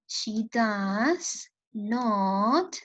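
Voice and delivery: she does not, spaced out word by word; very slow model. Shown as a demonstration. Teacher dictates the full negative form to her students. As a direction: spell it out slowly for learners. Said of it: "teacher slowly models negative form".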